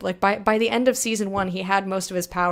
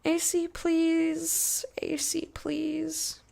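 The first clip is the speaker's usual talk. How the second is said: slow, high, breathy begging whisper; pleading whisper; 7 semitones higher, 5 dB quieter, much slower than usual. Pretend. Streamer begging a friend for the A C, whispered. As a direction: whisper a desperate plea.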